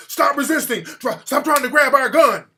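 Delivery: loud, high mock police shout; urgent retold shouting; imitated cops yelling. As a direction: yell it out like staged police orders. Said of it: "All the cops start yelling".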